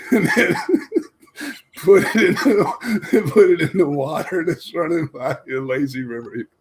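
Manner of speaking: breaking up with laughter mid-story; laughing while talking. Real, not acted. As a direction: struggle to get the words out through real laughter. Cracking up while telling story.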